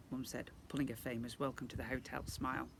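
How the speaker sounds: usual speaking voice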